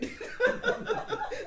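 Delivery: loud, high burst of laughing; real laughter. Real, not acted. Teller recalling her secret request, laughing out loud. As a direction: crack up helplessly.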